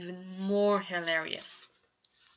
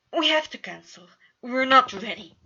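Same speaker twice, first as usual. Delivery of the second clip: loud, high panicked outburst; 5 semitones higher, 12 dB louder than usual. A narrator's reading. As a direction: blurt it out in panic. Panicked outburst before premiere.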